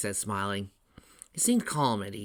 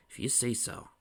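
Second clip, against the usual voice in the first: softer doubtful repeat; unconvinced; 2 semitones higher, 5 dB quieter than usual. A narrator's reading. Big brother still doubts Tails, unconvinced. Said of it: "not sounding convinced".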